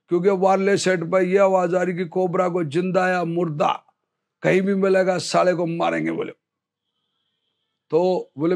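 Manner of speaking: ordinary delivery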